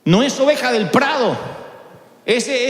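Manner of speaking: ordinary delivery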